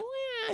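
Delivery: very high, soft little squeak; small falsetto creature noise; tiny creature mew. Retold or imitated. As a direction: mew like a tiny cute animal.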